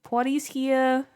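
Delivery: high, drawn-out catchphrase impression; Snooki. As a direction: stretch it out in a put-on party-girl voice. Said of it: "my best Snooki impression".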